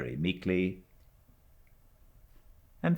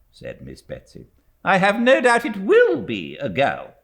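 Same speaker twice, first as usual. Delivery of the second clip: higher, louder, brisk dismissal; brusque old aunt; 7 dB louder than usual. A narrator's reading. Stern aunt hushing frightened niece, brusque. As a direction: wave the fuss away. Miss Betsy pronouncing.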